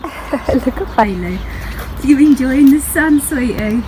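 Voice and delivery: high, slow sing-song pet talk; sweet-talking Bailey the dog in the sun. Real, not acted. Cooing at her dog Bailey.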